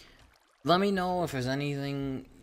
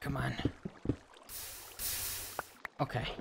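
ordinary talk, nothing marked

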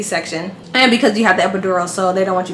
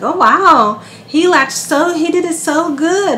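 nurses gushing; 7 semitones higher, slower than usual. Retold or imitated. Nurses praising the baby.

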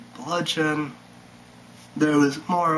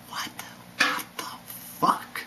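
whispered what the fuck; 7 dB quieter than usual. Real, not acted. Vlogger whispering his disbelief at the news. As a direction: hiss it under your breath.

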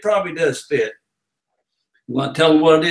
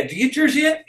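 higher, run together as one word; barker run-together call; 8 semitones higher than usual. Shown as a demonstration. Barker showing the host his pitch line, run-together call. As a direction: say it all in one breath like a barker. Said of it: "You had to say it all like that".

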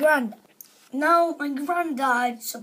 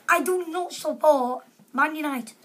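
ordinary talk

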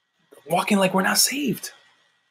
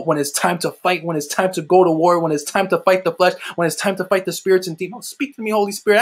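ordinary talk, nothing marked

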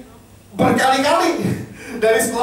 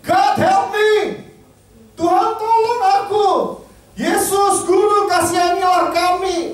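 high, slow desperate cry; screaming plea; 8 semitones higher, slower than usual. Retold or imitated. The ten sick men cry to Jesus, high desperate scream. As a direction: cry out for help at full pitch. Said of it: "they scream".

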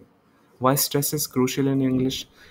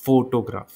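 Photograph with FO hit hard; stress on first syllable; much faster than usual. Shown as a demonstration. Teacher showing where the stress went, on FO. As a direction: punch the FO, let "to-graaf" fall away. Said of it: "उस पे ज़्यादा stress दिया".

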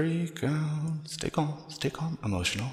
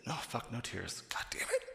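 frustrated whisper; 7 dB quieter than usual. Real, not acted. Frustrated whisper after failing to cry.

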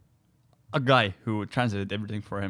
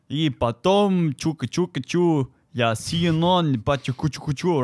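mock Russian teacher; 6 semitones higher, 5 dB louder than usual. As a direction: parody a teacher droning in Russian. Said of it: "they're like".

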